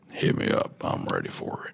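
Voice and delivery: very low, soft gritty cowboy; hushed Western outlaw rasp. Shown as a demonstration. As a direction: growl it quietly like an outlaw. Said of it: "if you need a cowboy".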